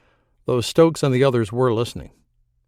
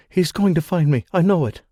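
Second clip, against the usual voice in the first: frightened; 6 semitones higher than usual. A narrator's reading. Frightened pregnant woman hiding.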